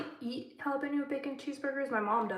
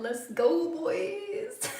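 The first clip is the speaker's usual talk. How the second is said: mock cheer; 6 semitones higher, much slower than usual. Jokey cheer to all-male audience.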